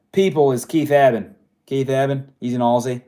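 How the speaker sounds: loud, badly faked Aussie accent; butchered Australian accent. Host naming a favorite Aussie singer, butchered Australian accent. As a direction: lightly put on an Australian accent. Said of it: "I'm butchering an accent right now".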